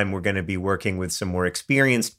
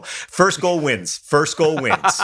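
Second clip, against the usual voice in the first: high, slow, drawn-out call; mock rule announcement; 6 semitones higher, slower than usual. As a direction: call it out slowly like a playful referee. Mock referee call to end game.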